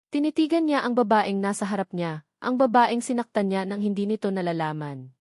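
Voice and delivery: usual speaking voice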